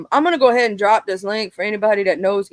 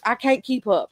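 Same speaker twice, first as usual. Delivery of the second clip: ordinary talk, nothing marked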